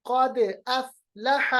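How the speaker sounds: slow क़द अफ़लह, d bounced, f held still; qalqalah. Shown as a demonstration. Teacher demonstrates, bounced क़द and unbounced अफ़लह. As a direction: echo क़द but stop the f of अफ़लह. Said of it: "फा की आवाज को हम रोकेंगे".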